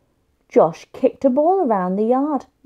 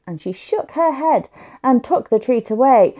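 plain reading voice

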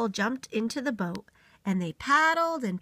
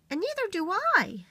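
little beaver; 10 semitones higher than usual. Acted.

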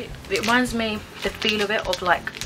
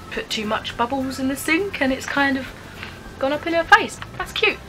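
rising high and bright with delight; delighted squeal; 6 semitones higher than usual. Real, not acted. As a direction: gush over the cute picture. Cute comic, voice rising high.

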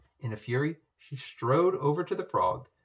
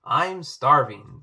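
hungry frog voice; 3 semitones higher, 10 dB louder, slower than usual. Acted. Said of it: "said the frog".

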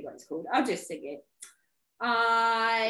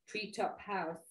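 ordinary delivery

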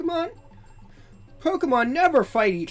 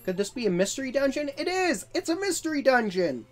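higher, faster excited realisation; excited discovery; 9 semitones higher than usual. Acted. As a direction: build up to a thrilled shout.

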